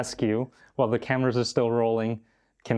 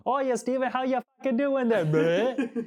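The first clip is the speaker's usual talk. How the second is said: put-on high, silly British accent ending in a bleh; mock British accent; 12 semitones higher than usual. Host showing friend how he goes, exaggerated British accent. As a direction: impersonate a friend's squeaky British greeting. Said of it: "You go like this".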